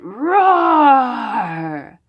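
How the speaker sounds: slow, loud roaring voice; lion roar. Acted. Mother asking about a lion, slow roaring voice.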